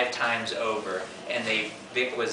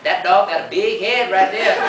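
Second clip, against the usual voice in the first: loud, raised, nasally uncle impression; 7 semitones higher, 8 dB louder than usual. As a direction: push it through the nose like uncle. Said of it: "it's a very nasally voice".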